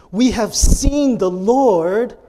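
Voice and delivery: high, loud, drawn-out proclamation; emphatic telling. Shown as a demonstration. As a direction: tell it with slow, ringing emphasis. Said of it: "it probably was not this reading".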